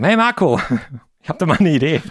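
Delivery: high, bright hey into a fast pitch; chummy phone greeting. Retold or imitated. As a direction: call up a buddy with an idea. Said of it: "ruf den gleich mal an und sag".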